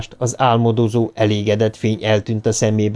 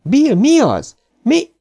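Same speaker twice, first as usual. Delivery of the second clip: higher, louder panicked questions; frightened cry; 4 dB louder, slower than usual. A narrator's reading. Beverly desperately asks Bill what is wrong, frightened. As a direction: beg him to answer, scared. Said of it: "kiáltott fel Beverly rémülten".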